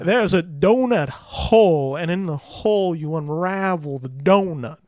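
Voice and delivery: an attempted Southern detective accent, unhurried; hammed-up Southern accent, slow and loud; Daniel Craig's Southern drawl. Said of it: "I can't do the accent".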